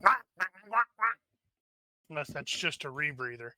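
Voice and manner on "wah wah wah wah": high imitated wah-wah babble; mock muffled speech. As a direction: imitate garbled talk as high wahs. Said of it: "probably sounds more like".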